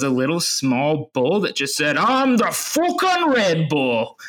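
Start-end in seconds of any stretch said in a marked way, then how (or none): 2.0-4.2 high, slow, hammed-up Geordie accent, far from his own voice. Telling the podcast hosts a Red Bull creature's line, hammed-up Geordie accent. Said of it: "in a, a Geordie accent".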